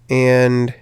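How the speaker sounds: ordinary talk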